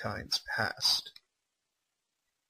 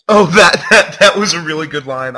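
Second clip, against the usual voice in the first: loud, higher, gleeful exclamation; much louder, excited and amused; delighted outburst; 5 semitones higher, 16 dB louder than usual. Real, not acted. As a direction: burst out in gleeful praise. Delighted reaction to a line.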